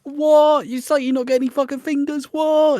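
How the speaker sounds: high, loud, baffled squeal; Ruben's outburst. Retold or imitated. Blunt mate at a festival, shrieking high and confused. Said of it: "Ruben again".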